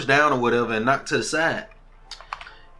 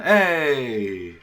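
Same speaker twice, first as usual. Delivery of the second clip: mock party cheer; 4 dB louder than usual. Dancers having a good time, mock cheer. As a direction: call out like a reveller.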